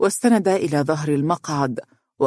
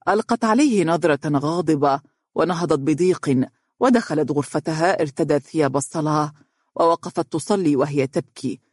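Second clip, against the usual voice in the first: plain reading voice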